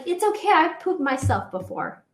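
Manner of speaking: high, light reassurance; mock the friend shrugging it off; reassuring friend. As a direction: friend comforting her after the confession, high and light. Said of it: "she was like".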